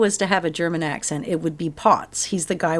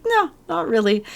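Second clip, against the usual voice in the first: mock doctor, much higher and offhand; breezy doctor; 9 semitones higher than usual. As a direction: toss off the reassurance in a high put-on voice. Quoting the doctor's reply.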